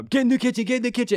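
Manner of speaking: high mock kid voice; taunting kid. Kid heckles her in the game, high taunt.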